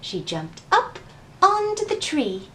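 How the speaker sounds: put-on gentle storybook reading voice for children; story narration. A narrator's reading. Teacher reading a picture book aloud to the children, gentle storytelling.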